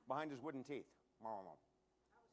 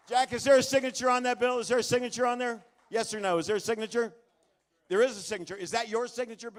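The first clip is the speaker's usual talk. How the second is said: loud questioning; 3 semitones higher, 11 dB louder than usual. Real, not acted.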